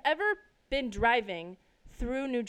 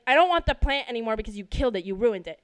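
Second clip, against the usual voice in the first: loud, fast, accusing tirade; angry outburst; 7 dB louder, faster than usual. Retold or imitated. Daughter blaming dad for the ruined plant, angry outburst. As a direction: rush it out loudly as an accusation.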